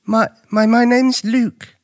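put-on high, slow, stumbling boy's voice; nervous stutter. A narrator's reading. Luke introduces himself to the unseen stranger, stuttering. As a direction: trip over the first word, timidly. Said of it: "Luke stuttered".

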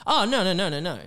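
high-pitched mock backpedal; hasty backtracking. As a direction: imitate someone frantically taking it back. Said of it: "you'll be like".

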